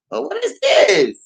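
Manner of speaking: high, slow what-is-this; exasperated mock question. To the car acting up again, high and slow. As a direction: drag it out high, fed up.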